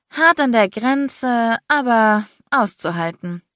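joking. A narrator's reading. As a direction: tease him, stretch it out. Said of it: "witzelte ich". (neckend, langsam gedehnt)

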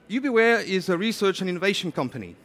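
ordinary delivery

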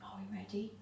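hushed whisper. Real, not acted. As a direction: whisper so the bunnies sleep. Kids pretending to sleep.

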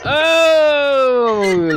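long high "oh" falling away; big drawn-out whoop, loud; loud cheering groan. Real, not acted. Dad reacting to son's joke, loud appreciative cheer.

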